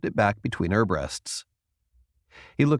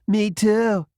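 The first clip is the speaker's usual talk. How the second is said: put-on higher, chirpy Valley Girl accent; 11 semitones higher, 4 dB louder than usual. A narrator's reading. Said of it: "Tin Woman's Valley Girl accent".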